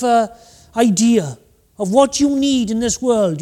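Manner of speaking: normal talking voice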